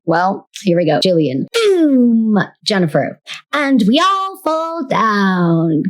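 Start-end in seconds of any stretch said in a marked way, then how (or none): 1.5-2.4 put-on high, drawn-out little-girl cry; high-pitched British girl. Two sisters playing make-believe, high-pitched British voice. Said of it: "talk in high-pitched British voices". 3.5-5.8 put-on slow, high singsong child voice; high-pitched British girl. Sister re-enacting a favorite memory, high-pitched British voice. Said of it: "talk in high-pitched British voices".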